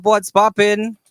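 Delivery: normal talking voice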